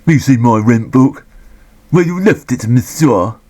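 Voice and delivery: put-on a rubbish, half-hearted French accent as Poirot; bad Poirot French accent. Reviewer voicing Poirot outside the pub, in a bad French accent. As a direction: mangle it in a lightly put-on Belgian-French accent. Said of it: "I've got rubbish accent syndrome".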